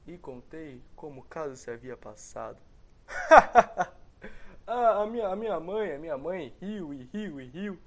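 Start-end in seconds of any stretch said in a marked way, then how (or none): 2.7-4.6 big hearty burst of laughter; loud laugh. Retold or imitated. Mother laughs. 4.7-7.8 laughing while talking. Real, not acted. Laughing right after laugh.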